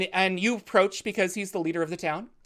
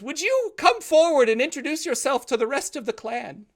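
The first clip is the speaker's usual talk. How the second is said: put-on old priest; 7 semitones higher, 4 dB louder than usual. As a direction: bless the crowd like an ancient holy man. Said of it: "and he says".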